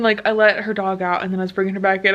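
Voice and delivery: usual speaking voice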